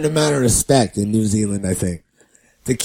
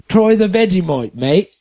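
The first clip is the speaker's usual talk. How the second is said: mock-serious Australian accent, higher pitched; 5 semitones higher than usual. Retelling the Australian turning serious over Vegemite, mock-grave Australian accent. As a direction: imitate an Australian insisting in earnest. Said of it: "And then he got serious".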